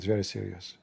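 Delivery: low, quiet, grave confiding voice; a hint of a gloomy naive man; solemn admin.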